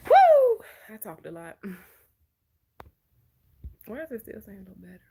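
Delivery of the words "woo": loud high-pitched "woo"; sudden loud whoop. Real, not acted. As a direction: let out a big high whoop. Spontaneous whoop while chatting on stream.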